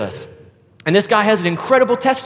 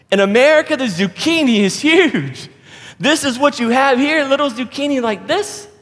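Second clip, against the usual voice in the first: pitched-up, scornful show-off; prideful American; 9 semitones higher than usual. Acted. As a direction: brag big, stretching "huge". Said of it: "this prideful American".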